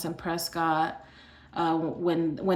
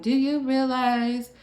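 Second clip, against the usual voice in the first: slow, higher-pitched mock scolding; retold exasperation; 6 semitones higher, slower than usual. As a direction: imitate a nurse getting mad, drawing out the words. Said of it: "getting mad at this point".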